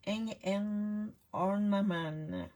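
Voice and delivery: "Hang and Ornament" said slowly with a French accent; careful, slow English in a French accent. Real, not acted. Naming the Christmas kit to her class, slow French-accented English. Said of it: "Excusez mon anglais".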